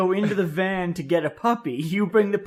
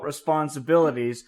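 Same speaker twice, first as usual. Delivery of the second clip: ordinary talk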